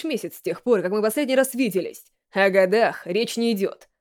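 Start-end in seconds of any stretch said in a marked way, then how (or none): none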